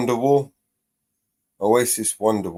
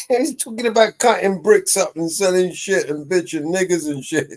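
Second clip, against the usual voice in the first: words pushed out through laughter, higher; laughing while talking; 8 semitones higher than usual. Real, not acted. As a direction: laugh as you explain. Laughing while explaining the lyrics.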